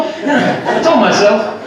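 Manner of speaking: ordinary delivery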